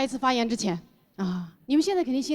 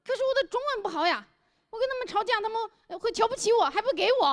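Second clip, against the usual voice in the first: put-on higher, pleading foreign-accented Mandarin; foreigner speaking mandarin; 9 semitones higher than usual. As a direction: whine like a foreigner with poor Chinese.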